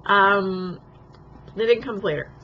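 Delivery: ordinary talk, nothing marked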